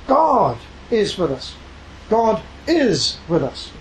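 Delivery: God is with us slowed right down, each word weighted; slow, deliberate preacher emphasis; every word emphasized. Shown as a demonstration. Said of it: "each one needs to be emphasized".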